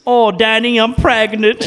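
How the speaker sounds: mock waitress breaking big news; lightly voiced Irish waitress. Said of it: "she's some Irish, and, and he, she goes".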